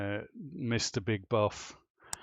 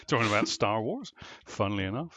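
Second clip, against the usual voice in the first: ordinary talk, nothing marked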